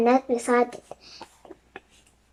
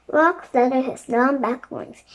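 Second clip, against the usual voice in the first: ordinary delivery, nothing marked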